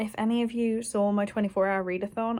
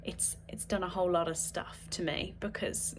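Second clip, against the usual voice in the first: ordinary talk, nothing marked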